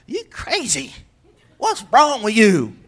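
slow, harsh accusation; mock accusing. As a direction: blame the relative. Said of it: "aunt or uncle and go".

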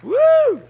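high, loud "woo"; excited whoop. Real, not acted. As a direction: let out a real preacher's whoop. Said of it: "I'm getting excited here".

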